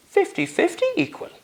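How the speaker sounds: "fifty fifty" questioned, not believing it; incredulous. Acted. Richard disbelieving the offer.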